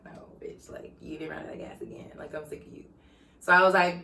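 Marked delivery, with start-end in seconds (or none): 0.1-2.8 retold scolding, almost whispered; hushed scolding. Woman imagining her boyfriend's reaction, hushed mock scolding. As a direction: scold under your breath, imitating him.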